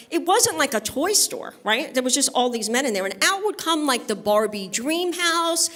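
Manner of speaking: normal talking voice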